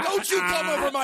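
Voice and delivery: high-pitched indignant protest; mock outrage. Mock outrage over moaning co-host.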